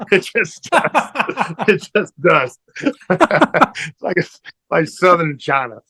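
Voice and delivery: helpless high-pitched laugh while talking; real laughter. Real, not acted. Cracks up at own accent attempts.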